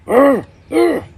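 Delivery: loud strained growl, high and pushed; two loud pained growls from a man watching wrestling. Retold or imitated. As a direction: roar like a man pinned in a wrestling hold.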